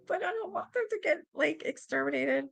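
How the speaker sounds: small, high, soft pleading voice; imitating own plea. Streamer replaying own plea for the rats, whimpery pleading. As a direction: plead softly, pitched well up. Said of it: "It's like".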